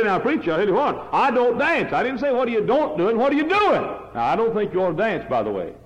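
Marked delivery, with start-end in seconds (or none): 0.0-1.9 high, mocking voice of a proud listener; mock excuse. Preacher mimics a churchgoer boasting he doesn't dance, mocking. 1.9-3.8 high, ringing rebuke; preaching at full force. Acted. Preacher corrects the churchgoer, high and forceful.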